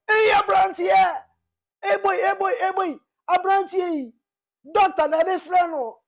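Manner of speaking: high, loud scolding auntie's voice; shrill-voiced rebuke, mocking the auntie. Retelling the auntie who scolded him, high and loud. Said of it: "She's like".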